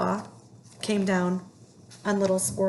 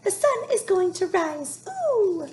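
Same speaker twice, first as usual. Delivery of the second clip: high squeaky squirrel voice; high chirpy squirrel cry; piped up; 11 semitones higher than usual. A narrator's reading. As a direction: pipe it up high and bright like a little squirrel. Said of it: "Little Brown Squirrel piped up".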